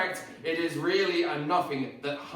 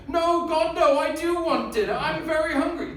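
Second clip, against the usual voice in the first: exasperated outburst; 11 semitones higher, 5 dB louder than usual. Acted.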